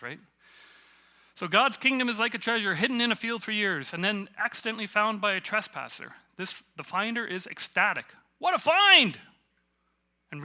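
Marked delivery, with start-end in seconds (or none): 1.3-6.1 loud, high, full-voiced reading; yelling scripture. Shown as a demonstration. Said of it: "He gave us permission to yell out scripture". 6.4-9.2 loud, high proclamation rising to a shout; yelling scripture. Shown as a demonstration. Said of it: "He gave us permission to yell out scripture".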